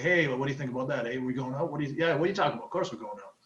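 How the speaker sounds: fast, run-together Canadian chatter; casual Canadian flow. Shown as a demonstration. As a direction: rattle it off like an average Canadian, softening off. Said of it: "the average Canadian just sounds like".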